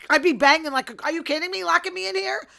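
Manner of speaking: high, loud mock outrage; indignant trapped protest. Woman found alive in the coffin, mock indignant shouting. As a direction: pretend to protest loudly, pitched well up.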